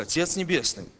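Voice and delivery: ordinary talk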